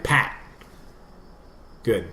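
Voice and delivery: ordinary talk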